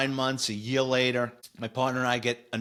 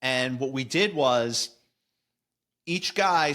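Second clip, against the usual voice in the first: ordinary talk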